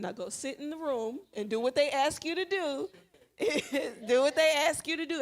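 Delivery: acted-out firm teacher telling-off; emphatic instruction. Acts out sending student back firmly.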